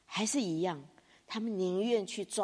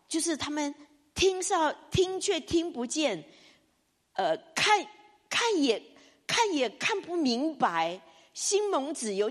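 higher voice that catches near a sob; anxious plea; 6 semitones higher than usual. Retold or imitated. Isaiah anxiously asking the Lord, near tears. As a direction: plead with God, close to tears. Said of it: "以赛亚就很着急".

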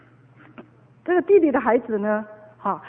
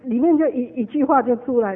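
ordinary delivery

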